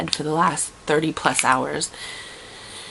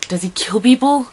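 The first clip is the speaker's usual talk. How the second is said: higher, louder startled question; alarmed; 6 semitones higher, 5 dB louder than usual. Retold or imitated. Nervous traveller to the diner lady, a louder alarmed question. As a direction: blurt it out in alarm.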